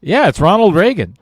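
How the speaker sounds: confident answer. Retold or imitated. Dazed player answering the friend's question loudly. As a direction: announce it brightly, certain. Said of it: "I said".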